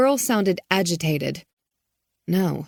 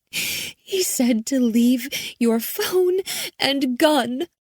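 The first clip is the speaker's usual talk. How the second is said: high, slow shaky-sounding message; a frightened girl repeating orders; scared girl; 8 semitones higher, slower than usual. A narrator's reading.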